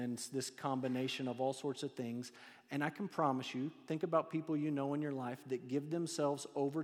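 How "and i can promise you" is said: ordinary talk, nothing marked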